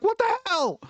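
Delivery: startled what the hell. Retold or imitated. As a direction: jump at the near miss. Appliance falls in front of him.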